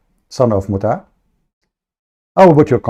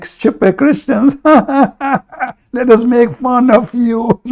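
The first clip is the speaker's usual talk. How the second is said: much higher, slow, laughing mockery; 11 semitones higher, much slower than usual. Streamer mimics Muslims jeering at Christians, high laughing mockery. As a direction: sneer it through a laugh, way up high. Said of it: "they say to you".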